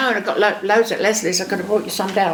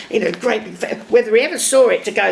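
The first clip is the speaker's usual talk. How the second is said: usual speaking voice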